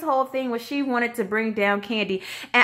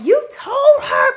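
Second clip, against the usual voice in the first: high, loud, incredulous squeal with a laugh; shocked disbelief; 7 dB louder, slower than usual. Retold or imitated. Kandi shocked at what Portia told Phaedra, high incredulous squeal. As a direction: shriek it in disbelief, half laughing.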